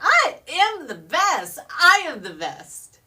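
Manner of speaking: loud, exultant affirmation; cadence at full force. Shown as a demonstration. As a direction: belt it out as the chant peaks. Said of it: "it starts to gain a cadence".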